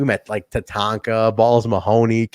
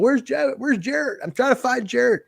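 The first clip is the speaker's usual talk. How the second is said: high frantic mock voice asking for Jared; mock searching for Jared, pitched way up; imitating Watts; 11 semitones higher than usual. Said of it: "He's like".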